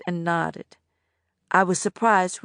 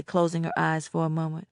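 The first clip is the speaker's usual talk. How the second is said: usual speaking voice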